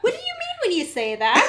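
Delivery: mock high voice. Voicing Freya in joint retelling.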